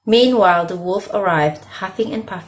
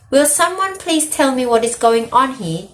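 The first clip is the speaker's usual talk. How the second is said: shouted; 6 semitones higher than usual. A narrator's reading.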